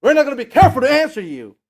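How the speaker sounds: loud, quick flat refusal; defiant answer. Retold or imitated. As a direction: refuse the king point-blank. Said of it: "the way they answered".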